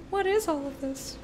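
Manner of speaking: high-pitched baffled reaction; mock bewilderment. Mock bewilderment at changed chat.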